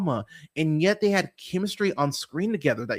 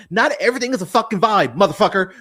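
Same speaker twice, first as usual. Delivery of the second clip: louder, fast and fed up; angry retort; 4 semitones higher, 7 dB louder, faster than usual. Retold or imitated. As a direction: snap it back at him, fast and loud.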